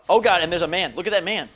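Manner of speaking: loud oh God there's a man; real startled outcry. Real, not acted. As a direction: blurt it in alarm. Spots snake man.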